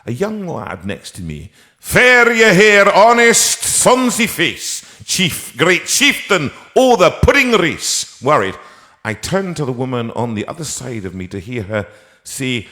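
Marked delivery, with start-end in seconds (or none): 1.8-8.2 loud, slow declaiming Scottish lad reciting Burns; Scottish accent. Retold or imitated. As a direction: boom out Burns in a thick Scots accent. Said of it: "try your wee accent out".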